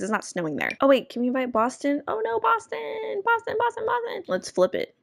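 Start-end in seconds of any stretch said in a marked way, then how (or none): 2.1-4.2 mock wail. Sim friend leaving, calling out.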